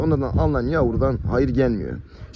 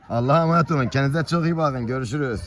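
ordinary talk, nothing marked

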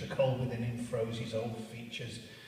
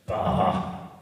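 scornful bah; 4 semitones lower, 8 dB louder than usual. Acted. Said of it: "said Scrooge".

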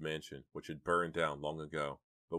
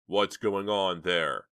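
loud, slow challenge; countering; 3 semitones higher, 9 dB louder, much slower than usual. A narrator's reading. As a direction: demand to know, pushing back loudly. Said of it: "countered Chuck".

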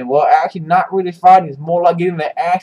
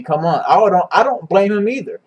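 usual speaking voice

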